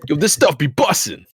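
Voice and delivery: imitating hyped kids. Mimicking the slang kids say, hyped up. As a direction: belt it out like an excited kid. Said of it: "the word that they actually say".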